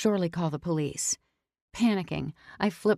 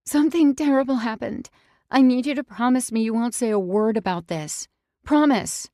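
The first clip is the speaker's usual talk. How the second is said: high, loud, urgent plea; manipulative distress; 6 semitones higher, 7 dB louder than usual. Acted. Manipulating the ex into silence, alarmed and pleading. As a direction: sell the panic to make him promise. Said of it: "use your manipulation".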